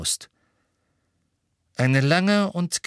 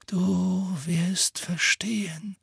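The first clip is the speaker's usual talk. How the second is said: slow, drawn-out whisper; 8 semitones higher, much slower than usual. A narrator's reading. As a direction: whisper it slowly, as a parting promise. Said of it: "flüsterte Dumbledore".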